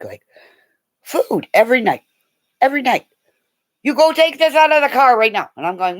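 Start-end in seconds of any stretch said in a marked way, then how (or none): 3.8-5.5 bossy order. Retold or imitated. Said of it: "Yolanda ordering her retold".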